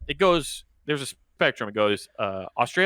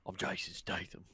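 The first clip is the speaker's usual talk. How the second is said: put-on much softer, murmured English accent; Jason Statham voice; 5 semitones lower, 17 dB quieter than usual. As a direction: reset into Statham's English accent, near whisper. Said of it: "You gotta keep the voice".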